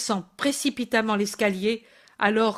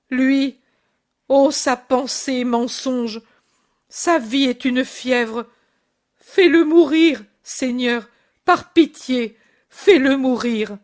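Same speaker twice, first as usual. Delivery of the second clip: loud, desperate cry to God; a mother's anguished outcry; anguished plea; 3 semitones higher, 5 dB louder than usual. Acted. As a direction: beg for his death in anguish. Mother's anguished plea about son.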